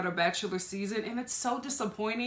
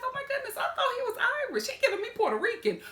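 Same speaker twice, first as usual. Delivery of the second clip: much higher, fast, laughing gush; gushing sister; 13 semitones higher, faster than usual. Retold or imitated. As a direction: squeal it like a gossiping friend. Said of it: "You'll be like".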